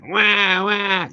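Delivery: high whining wah wah; imitating her fit in the background; mock crybaby wails. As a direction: whine it like a spoiled toddler. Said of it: "She's throwing a fit".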